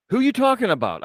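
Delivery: high, incredulous mock question; retold bafflement. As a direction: act out total confusion. Retold baffled back-and-forth argument.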